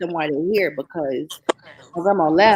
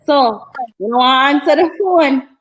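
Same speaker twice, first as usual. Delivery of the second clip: put-on raised, louder Jamaican accent; imitating grandma's Jamaican voice; 6 semitones higher, 6 dB louder than usual. Granddaughter imitating grandma's voice message, Jamaican accent. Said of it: "in her Jamaican voice".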